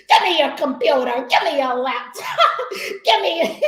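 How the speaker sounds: loud orders through laughter; mock TSA yelling. TSA agent demanding a laptop, laughing mock yell. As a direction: demand it like a guard, cracking up. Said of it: "they straight up yelling at you".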